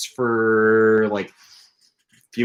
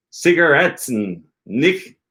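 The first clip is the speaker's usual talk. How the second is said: slow, raised imitation of a lecturer; slow demonstration; 5 semitones higher, slower than usual. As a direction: stretch the words out as if teaching. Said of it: "does it slowly".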